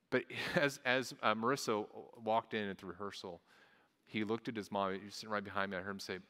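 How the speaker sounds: usual speaking voice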